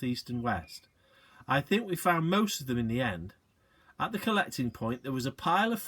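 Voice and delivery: ordinary delivery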